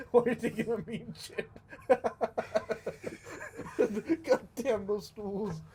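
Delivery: high, loud laughing words. Real, not acted. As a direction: crack up while saying it. Friend cracking up mid-joke.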